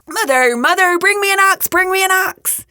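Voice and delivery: high, urgent shout; Jack calling out. A narrator's reading. Jack calling his mother while climbing down, urgent high call. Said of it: "So he called out".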